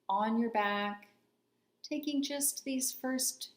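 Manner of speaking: unhurried meditation voice with long pauses between phrases; calm, spacious meditation guidance; guided body scan meditation. Real, not acted. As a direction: guide them gently into stillness, leaving space after each phrase.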